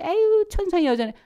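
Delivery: high, loud mock old lady sighing 아유 천상 여자네; old folks' remark. Elders teasing a shy girl, high mocking voice. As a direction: imitate a tutting elder, way up high. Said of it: "그게 너무너무 싫은데".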